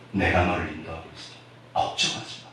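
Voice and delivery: very soft, near-whispered reassurance; gentle voice of God. Acted. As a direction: murmur it as God comforting. God speaking comforting words. (부드럽게 속삭이며 위로하듯)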